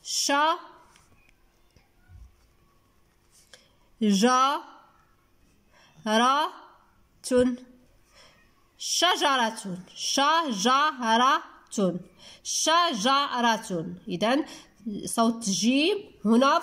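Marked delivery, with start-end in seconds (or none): 0.0-7.6 ش-، جا-، را-، تون as isolated beats with long pauses; split into syllables. Shown as a demonstration. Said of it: "حاولوا نكتبوها". 9.9-14.0 شجرة slowly as sha, ja, ra, tun; split into syllables. Shown as a demonstration. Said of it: "في المقطع الثاني".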